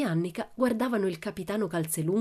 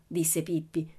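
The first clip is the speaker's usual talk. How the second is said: plain reading voice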